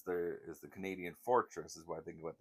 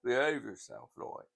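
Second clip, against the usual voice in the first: slower, higher Cockney scolding; Cockney impression; 4 semitones higher, 5 dB louder than usual. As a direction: tease like old George. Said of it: "then he'd go".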